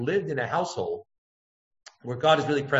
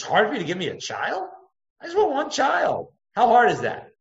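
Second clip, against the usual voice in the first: Chana's plea; 8 semitones higher than usual. Retold or imitated. Voicing Chana pleading to God.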